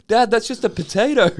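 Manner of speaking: high, loud mock kid voice; protesting child. Kid objecting to dad over a potato, high and loud. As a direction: pipe up like a child correcting dad.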